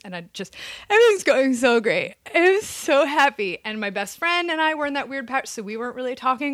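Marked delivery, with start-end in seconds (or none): 0.9-3.6 high, loud mock-crying cheerfulness; hammed-up weepy insistence that all is fine; tearful fake cheer. Daughter in tears on calls to parents, mock-tearful cheer. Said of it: "I would be in tears".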